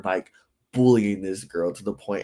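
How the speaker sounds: normal talking voice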